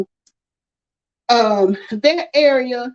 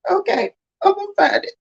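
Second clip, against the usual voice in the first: tearful resolve; 7 semitones higher than usual. Retold or imitated. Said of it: "I'm like".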